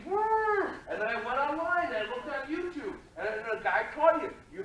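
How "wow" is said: a much higher voiced squeal of an elephant; the amateur version, a high voiced yelp; old elephant noise. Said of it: "My elephant noise used to be".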